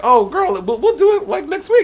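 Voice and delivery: very high, sugary fake promise; string her along sweetly; mock sweet brush-off. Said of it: "And I'm like".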